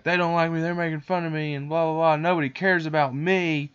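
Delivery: hammed-up aggrieved boy; mock self-pity. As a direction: imitate a kid protesting he's wronged. Boy's retold complaint to bears.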